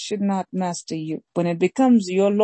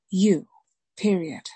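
preaching refrain; 2 semitones higher, slower than usual. Acted.

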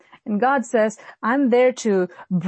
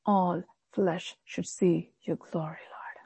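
slow, hushed prayer whisper; 4 semitones lower, 8 dB quieter than usual. Shown as a demonstration. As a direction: breathe the prayer softly and slowly. Modelling a humble prayer.

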